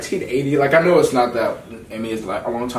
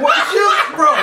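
much higher and louder, mock disbelief; stunned outburst; 4 dB louder than usual. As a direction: shout it like you can't believe your eyes. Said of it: "I was like".

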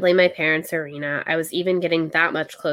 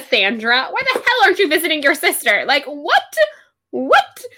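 loud, fast, high, laughing through it; louder and faster, mock outrage at Cassandra; exasperated rant; 8 semitones higher, 7 dB louder than usual. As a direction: scold an absent sister, half-laughing. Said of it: "But I'm also like".

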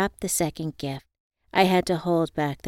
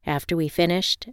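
usual speaking voice

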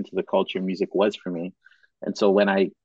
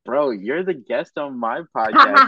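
mock indignation; 9 semitones higher, 4 dB louder than usual. Real, not acted. Host mock outrage at guest.

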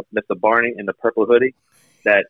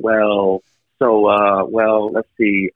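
slow, hesitant filler talk; filler words; much slower than usual. Shown as a demonstration. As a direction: drag out the ums and wells. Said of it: "what we call verbal garbage".

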